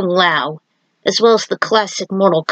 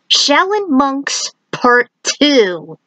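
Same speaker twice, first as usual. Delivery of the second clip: title announcement; 6 semitones higher, slower than usual. Acted.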